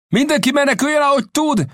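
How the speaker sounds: very high, urgent shout; captain's alarm cry. A narrator's reading. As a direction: call all hands to flee. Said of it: "kiáltotta a kapitány".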